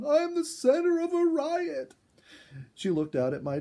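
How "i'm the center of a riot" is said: much higher, louder comic distress; mock panic. A narrator's reading. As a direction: cry out, half laughing at the chaos. Overrun by raccoons, calling out.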